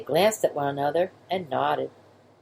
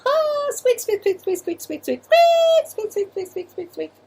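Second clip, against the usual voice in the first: high squeaking giggle fading out; squeaky mouse laughter; 3 dB louder than usual. Acted. Baby mice laughing on their backs, squeaking. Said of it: "and they laughed".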